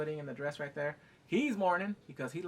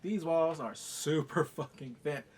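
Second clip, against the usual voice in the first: ordinary talk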